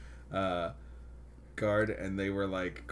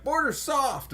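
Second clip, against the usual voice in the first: mocking; 6 dB louder, slower than usual. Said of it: "everyone's always like".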